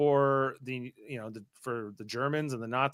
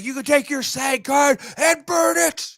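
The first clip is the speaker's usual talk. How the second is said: high, loud and drawn-out movie rant; acted outburst, pitched way up; shouted movie quote; 12 semitones higher, 7 dB louder than usual. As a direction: shout it slowly like an outraged film character.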